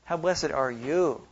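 YOU drawn out with a fall; slow reading that lands long on YOU; stress on YOU. Shown as a demonstration. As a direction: lean slowly on the final you. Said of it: "then also emphasize different perspectives".

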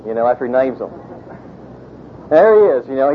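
ordinary talk

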